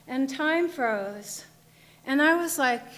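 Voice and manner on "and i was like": ordinary delivery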